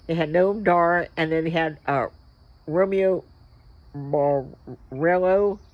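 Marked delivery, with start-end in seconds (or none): none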